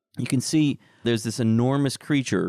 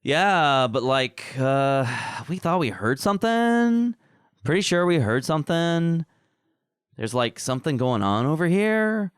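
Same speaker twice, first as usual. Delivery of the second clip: higher, nervous-sounding creature voices; other creature voices; 6 semitones higher than usual. Acted. Game master voicing lesser creatures who heard something, higher creature voice. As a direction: pitch it up like a jumpy underling. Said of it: "you hear these other voices say".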